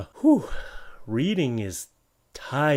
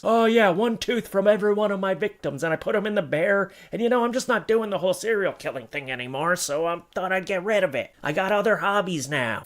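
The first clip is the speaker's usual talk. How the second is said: mock serial killer; 6 semitones higher than usual. Playing a retired serial killer.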